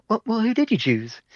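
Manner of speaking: a squeaky, impatient voiced question; high mock demand. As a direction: ask it way up high, exasperated. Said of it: "He's like".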